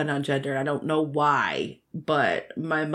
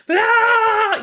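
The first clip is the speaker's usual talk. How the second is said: high shrieking charge yell; battle cry; 8 dB louder than usual. Pretend. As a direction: shriek like a tiny warrior.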